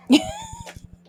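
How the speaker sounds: very high squealing laugh. Real, not acted. Owner squealing with delight at her dog. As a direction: burst into a squeal.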